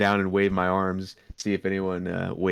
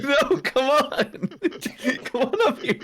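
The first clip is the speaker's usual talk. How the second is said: laughing through the words; laughing call; slower than usual. Acted. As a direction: beckon them while laughing. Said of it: "I'm gonna be like".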